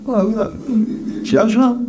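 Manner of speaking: high, hesitant, submissive; meek clerk. A narrator's reading. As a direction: give in meekly in a high little voice. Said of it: "said Cratchit meekly".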